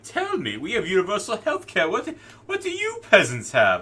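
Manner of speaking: high, loud Cockney sneer; hammed-up Cockney jeer at the peasants; mock Cockney. British comic character taunts Americans over health care, mock Cockney. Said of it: "word it in the Cockney accent".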